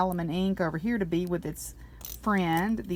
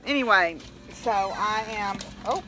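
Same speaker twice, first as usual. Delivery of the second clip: normal talking voice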